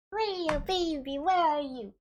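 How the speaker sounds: high, slow cooing call to a cat; singsong pet-calling, pitch up. Real, not acted. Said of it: "it's my cat voice".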